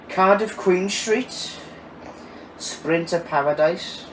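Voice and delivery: slow, lilting attempt at a Welsh accent; lightly performed Welsh accent; best Welsh accent. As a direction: draw it out in a put-on Welsh accent. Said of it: "that's my best Welsh accent".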